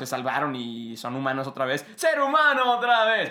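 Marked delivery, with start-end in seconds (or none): 2.0-3.3 shouted high and loud; excited shout. Acted. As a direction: burst out in celebration. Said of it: "Perdón, tenía que ser".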